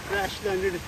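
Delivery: ordinary talk, nothing marked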